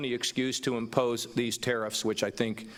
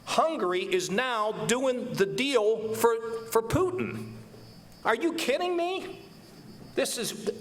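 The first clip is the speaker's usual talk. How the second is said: emotional; 6 semitones higher than usual. Real, not acted. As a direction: voice climbing much higher in rising exasperation. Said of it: "I'm emotional about this".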